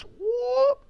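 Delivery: loud, very high whoop. Real, not acted. Closing her beatbox bit.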